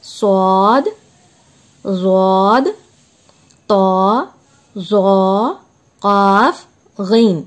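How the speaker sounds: each letter sounded slowly and clearly; teacher reciting Arabic letter names to students, measured and clear; Arabic letter recitation. Shown as a demonstration. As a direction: recite सौद, ज़ौद, तौ, ज़ौ, काफ, गैन one by one with pauses. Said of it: "ये हुर्फ मुस्तालिया है खा".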